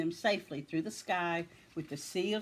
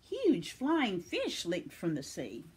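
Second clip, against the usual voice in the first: plain reading voice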